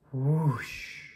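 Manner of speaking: gentle exhaled whoosh; blowing out breath. Acted. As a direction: blow it out like candles. Blowing out imaginary candles.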